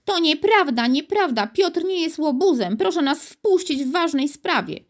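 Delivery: put-on high-pitched girl's protest; outraged, insistent little girl; indignant calling out. A narrator's reading. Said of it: "oburzona i wołała ile sił".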